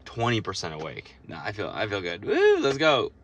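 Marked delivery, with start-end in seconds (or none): 2.2-3.1 hyped up. Real, not acted. Hyping himself up.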